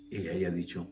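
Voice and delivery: ordinary talk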